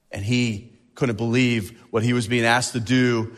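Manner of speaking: ordinary talk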